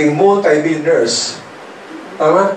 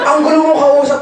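whiny scold; 9 semitones higher than usual. Retold or imitated. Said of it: "Ang sabi sa akin".

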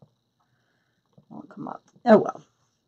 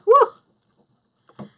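high mock whoop; playful high woo; joking woo. Real, not acted. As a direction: whoop it as a light joke about the cold. Reacting to a viewer's cold weather.

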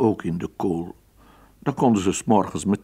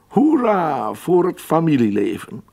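higher, louder cheer; cheering moral; 7 semitones higher, 5 dB louder than usual. A narrator's reading. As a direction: end the tale with a hurrah.

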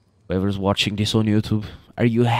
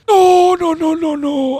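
very high-pitched cry of alarm; 4 dB louder than usual. Real, not acted. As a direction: cry out high in a sudden panic. Dinosaur in danger of dying.

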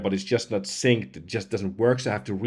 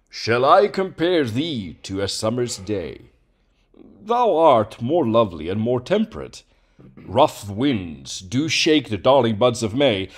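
theatrical reading; 4 semitones higher, 6 dB louder than usual. Shown as a demonstration. Reading the sonnet for the voice changer, theatrically declaimed. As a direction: declaim it grandly like on stage.

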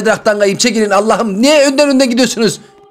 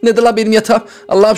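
usual speaking voice

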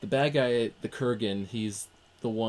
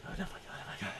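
whispered, breath-only muttering; hushed mock horror; 13 dB quieter, faster than usual. Teller imitating onlookers in a church scene, horrified whisper. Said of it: "they were just sitting there like".

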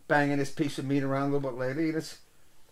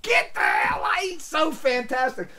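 mock cheering; 9 semitones higher than usual. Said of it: "We're like".

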